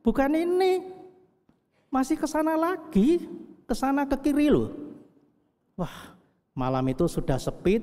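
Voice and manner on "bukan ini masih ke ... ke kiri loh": high, startled protest to the driver; mock alarm, the wrong hotel; retold surprise. Preacher retelling his alarm at the wrong hotel, high and startled. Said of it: "Saya turun".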